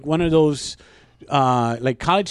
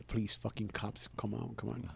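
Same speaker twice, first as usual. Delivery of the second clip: very soft, low pleading under the breath; muttered plea; 5 semitones lower, 17 dB quieter than usual. Retold or imitated. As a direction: plead quietly to yourself, barely audible. Silently hoping the police come.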